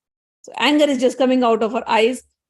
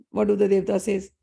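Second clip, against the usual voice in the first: ordinary delivery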